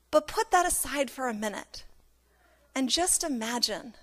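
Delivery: very soft, with long pauses; hushed invitation. Real, not acted. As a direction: gently draw listeners into imagining. Inviting listeners to imagine, hushed.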